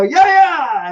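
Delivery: high, loud mock surprise shout; hammed-up surprise shout; surprise yell. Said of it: "I go".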